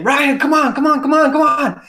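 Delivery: high, loud urgent calling; retold frantic yell, high and loud; imitated screaming. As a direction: scream for someone down the mountain to come. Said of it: "I heard screaming from down mountain".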